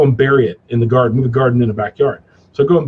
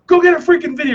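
mock dad order; 13 semitones higher than usual. Dad voiced in story.

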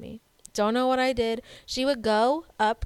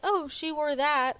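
surprised thought; 6 semitones higher, slower than usual. Retold or imitated. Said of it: "I was like".

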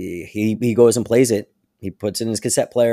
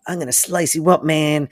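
a hint of a menacing thug, a bit higher; lightly voiced street tough making a threat; mild mock thug menace; knife-wielding thug; 6 semitones higher than usual. Thug pulls knife, threatens Batman.

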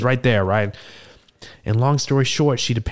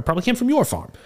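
mock high. Bard jokes at tavern.

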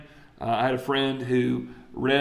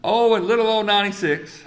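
mock prophet; 7 semitones higher, 5 dB louder than usual.

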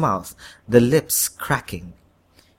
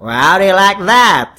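put-on Southern accent; 7 semitones higher, 9 dB louder than usual. A narrator's reading. Devil posing as the president shows off, in a Southern accent. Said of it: "said in a southern accent".

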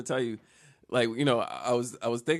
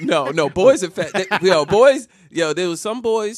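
emphatic, loud and raised; loud insisting; 5 semitones higher, 10 dB louder, slower than usual. Real, not acted. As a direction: insist over the laughter.

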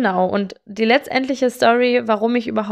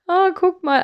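surprised quote; 9 semitones higher than usual. Retold or imitated. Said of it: "zu meiner besten Freundin gesagt so".

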